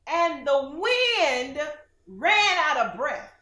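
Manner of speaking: slow, loud, high reading; dramatic reading. Acted. Preacher reading the Message Bible with relish, dramatic. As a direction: savor the words.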